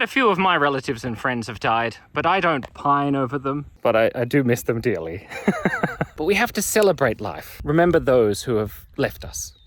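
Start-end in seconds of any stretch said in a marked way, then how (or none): none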